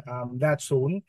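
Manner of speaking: normal talking voice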